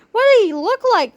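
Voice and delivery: high, eager questioning; cheerleader voice. A narrator's reading. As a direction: ask it wide-eyed. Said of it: "they asked".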